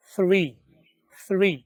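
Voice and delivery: "Three, three" with a breathy th, no hard t; "three" with air through the teeth; soft breathy TH. Shown as a demonstration. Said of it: "आपने क्या बोलना है?".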